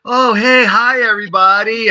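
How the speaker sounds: high, bright hello to the room; cheerful greeting. Retold or imitated. Roberta joining the meeting, greeting everyone cheerfully. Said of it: "She's like".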